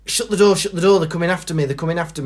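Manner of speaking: high, fast, hammed-up panic; frantic old man. Old man falling through the door, high and frantic.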